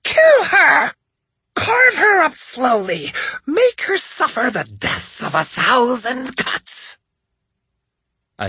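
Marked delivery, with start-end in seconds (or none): 0.0-7.0 high, loud, then slow cruel order; savage shout. A narrator's reading. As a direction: command the slaughter with relish. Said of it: "she cried".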